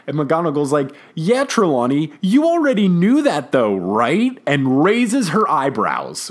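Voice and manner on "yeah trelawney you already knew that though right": imitated sassy challenge, pitched up. Professor needling the psychic colleague over what she knew, sassy. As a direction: egg someone on with a pointed, rising tease. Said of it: "So sassy".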